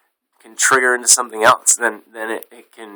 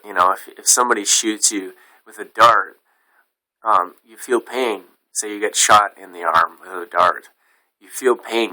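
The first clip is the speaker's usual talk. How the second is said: ordinary delivery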